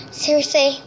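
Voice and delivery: normal talking voice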